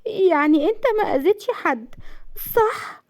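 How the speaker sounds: very high, pleading tearful voice. A narrator's reading. Wife seeking assurance he hurt nobody, tearful. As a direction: beg for reassurance through tears. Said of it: "نزلت دموعها وقالت".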